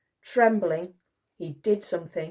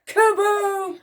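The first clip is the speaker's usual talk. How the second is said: high, loud drawn-out kaboom; explosive boom; 6 dB louder than usual. A narrator's reading. Reader sounding the exploding barrel, explosive. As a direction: burst it out like an explosion.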